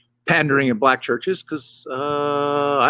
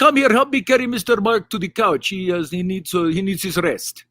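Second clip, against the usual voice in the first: put-on raised, eager Arabic-accented host; Egyptian host accent; 4 semitones higher than usual. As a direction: play the fussy Egyptian host. Egyptian host greeting guest.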